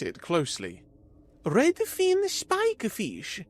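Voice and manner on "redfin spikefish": high, slow, wondering alien voice; genuine surprise. A narrator's reading. Zira inspecting Carlos's rare fish, surprised. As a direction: let real surprise lift the name. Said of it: "genuine surprise in her voice".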